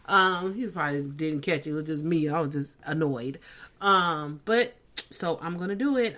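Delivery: ordinary delivery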